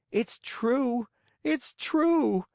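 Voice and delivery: put-on very high puppet-boy wail, rising on the repeat; tearful cry. A narrator's reading. Scolded marionette admits fault to the glowworm, tearful. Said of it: "cried Pinocchio in tears".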